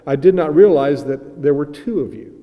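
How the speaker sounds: loud, lightly mock apology to Paul; a hint of the preacher realizing his mistake; old preacher apologizing. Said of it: "said the old preacher".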